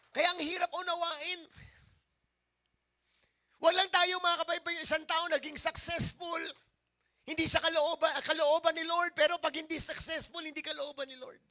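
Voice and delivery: loud, rising preacher's drive; full-force preaching. Acted. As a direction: pastor pushing the point to the congregation, preaching hard.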